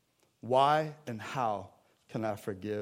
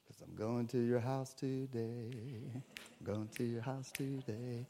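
gentle invitation; 10 dB quieter than usual. Acted. Jesus voiced gently to Zacchaeus.

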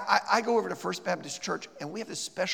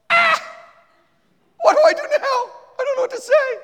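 much higher, laughing mock panic; flustered panic; 13 dB louder than usual. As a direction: squeal it out, caught off guard and lost for words. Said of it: "And you're like".

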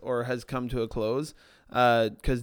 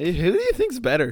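higher, louder boastful challenge; hammed-up disciple bragging to the others; mock disciple; 7 semitones higher, 7 dB louder than usual. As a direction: play a petty disciple picking a fight. Disciples arguing who's greatest.

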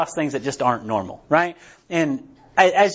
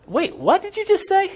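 high-pitched mock disbelief; acted-out incredulous question; startled interruption; 13 semitones higher than usual. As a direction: imitate a startled woman cutting in, voice pitched way up. Acts out Paige's startled interruption.

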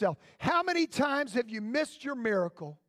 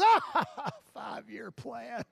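amused chuckle; 2 semitones lower, much slower than usual. Acted. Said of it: "God looks back and goes".

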